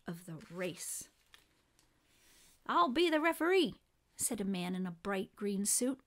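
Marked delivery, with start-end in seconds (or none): 2.7-3.8 high, loud referee's announcement; raised, officious volunteer voice; announcing. A narrator's reading. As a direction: announce yourself over a crowd. Man announces himself as referee.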